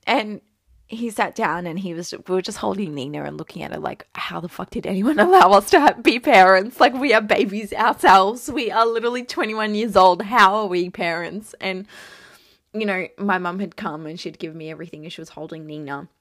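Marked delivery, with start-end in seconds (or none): 4.1-11.5 loud, laughing disbelief, slowing down; loud laughing wonder at being parents; amazed disbelief. Retold or imitated. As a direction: blurt it out, half laughing at yourselves.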